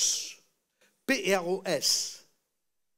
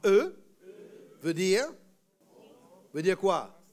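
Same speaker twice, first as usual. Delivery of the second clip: loud voice; 4 dB louder than usual. Shown as a demonstration. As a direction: call it out for the class to echo. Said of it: "à haute voix". (à voix haute, plus aiguë)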